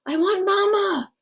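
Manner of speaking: put-on high, small child's plea; a little boy wanting his mother; pleading child.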